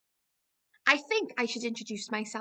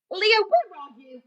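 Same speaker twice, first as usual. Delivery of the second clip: calling out; 6 semitones higher, 9 dB louder than usual. Acted. Calling for hiding shy friend.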